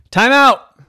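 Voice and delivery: loud, high announcement; referee's call. Acted. Referee stops the game for a timeout, loud call. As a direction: call it sharply across the court.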